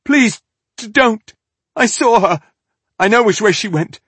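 high, loud desperate begging; panicked captive pleading; terrified pleading. A narrator's reading. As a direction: beg for your life with a stammer. Captive begs at sword point.

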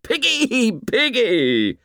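very high-pitched mocking boy's cry; gleeful shriek. A narrator's reading. Ralph jumps up taunting the fat boy, gleeful high shriek. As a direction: jeer it in a high, squealing schoolboy voice. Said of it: "Ralph shrieked with laughter".

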